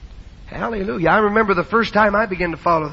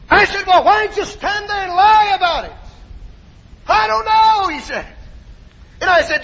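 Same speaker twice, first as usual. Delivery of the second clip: testimony building to high, loud shouts; high revival fervor, acting out both sides; full-force preaching; 6 semitones higher, 4 dB louder than usual. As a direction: preach the story up to a shouted climax.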